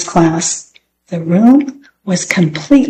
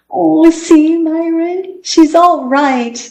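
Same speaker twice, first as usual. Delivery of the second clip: put-on higher, slow happy little girl voice; a girl glad her dog is fine; relieved girl; 9 semitones higher, slower than usual. A narrator's reading. Girl showing Myron her healed dog, relieved and happy.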